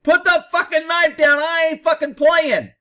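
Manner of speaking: imitating a cop's urgent yell; urgent command. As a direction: yell it like you mean it. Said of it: "Now he's talking like I think he should".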